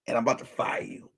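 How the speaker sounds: fast whispered threat. Real, not acted. Warning Kenny the cameraman, whispered threat. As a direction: hiss it fast under the breath.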